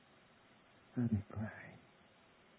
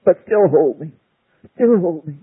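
louder, urgent begging; loud desperate plea; 3 semitones higher, 10 dB louder than usual. Acted. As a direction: press the plea hard.

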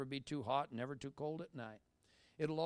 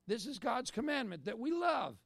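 rising fervour; 9 semitones higher, 3 dB louder than usual. Real, not acted. Preaching climax.

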